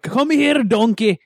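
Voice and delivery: put-on high, hearty ogre call in a Scottish accent; calling out as a mock Scottish ogre; Shrek. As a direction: do a cartoon Scottish ogre impression. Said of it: "like, the Shrek voice".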